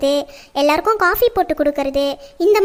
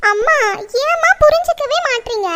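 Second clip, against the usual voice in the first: high-pitched, frustrated protest; daughter's high, exasperated whine; exasperated plea; 8 semitones higher than usual. Acted. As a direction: plead to be understood. Daughter frustrated with insistent mother.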